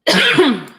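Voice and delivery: real loud, hard cough; choking cough. Real, not acted. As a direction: cough hard to clear a choke. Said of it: "About to choke".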